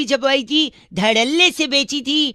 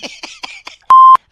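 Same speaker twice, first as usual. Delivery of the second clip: loud high laugh; burst of laughter; 10 dB louder than usual. Real, not acted. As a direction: crack up loudly.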